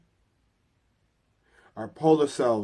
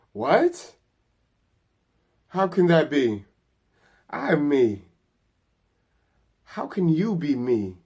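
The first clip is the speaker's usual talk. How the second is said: high, raised voice in astonishment; baffled disbelief; 3 semitones higher, 5 dB louder than usual. A narrator's reading. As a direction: question it incredulously, pitch leaping up. Character baffled by the voice.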